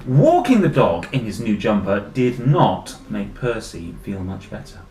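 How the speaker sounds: animated read-aloud with big emphatic key words; story narration. A narrator's reading. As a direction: read dramatically to young children. Reads story aloud to children.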